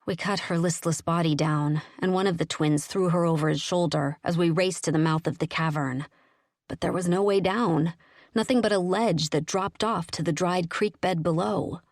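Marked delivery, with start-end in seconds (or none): none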